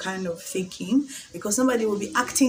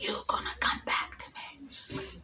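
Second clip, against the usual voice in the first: soft, slow spell-like whisper; whispered prayer; 7 dB quieter, slower than usual. Retold or imitated. Praying her ex back, a secret whispered spell. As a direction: whisper it like casting a charm. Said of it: "sometimes I used to even pray".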